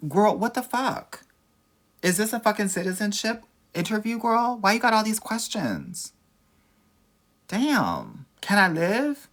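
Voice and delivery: high, loud, fed-up rant; exasperated outburst. Real, not acted. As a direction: blow up at a nosy question. Exasperated rant at viewer question.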